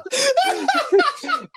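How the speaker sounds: high squealing laugh burst; high shrieking laugh. Real, not acted. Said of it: "big laugh".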